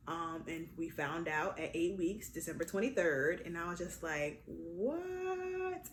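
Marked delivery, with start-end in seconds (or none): 4.5-5.9 long, drawn-out high what; high, lingering note of disbelief; retold disbelief. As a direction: stretch it out in amazement. Retold shock at the news.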